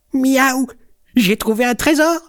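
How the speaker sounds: high, gleeful kitten voice; triumphant, high-pitched crowing; excited cry. A narrator's reading. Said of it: "crie Jean Chat en se frottant les pattes".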